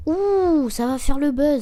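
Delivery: high, loud hype; whoop, then hype it up loudly; excited whoop. Real, not acted. Streamer as the first review arrives, loud excited whooping.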